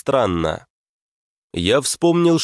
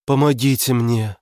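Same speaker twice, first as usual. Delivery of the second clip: pained creature; 4 semitones higher than usual. Acted. Fox head in belly cries.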